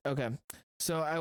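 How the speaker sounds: usual speaking voice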